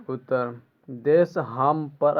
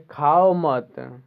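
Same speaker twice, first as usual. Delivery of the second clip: slow, loud खाओ मत as one command; खाओ मत held together with no break; मत joined to खाओ; 6 dB louder, much slower than usual. Shown as a demonstration. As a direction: stretch खाओ straight into मत. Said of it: "ख number में क्या दिया".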